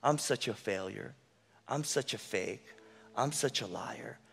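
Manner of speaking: soft, dejected self-talk; quiet self-blame. Retold or imitated. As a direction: mutter your failures to yourself. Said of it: "you say".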